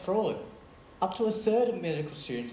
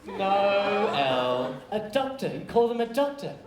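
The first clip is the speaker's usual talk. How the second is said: loud punchline delivery; emphatic; 4 semitones higher, 5 dB louder, slower than usual. Acted. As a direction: land the joke hard.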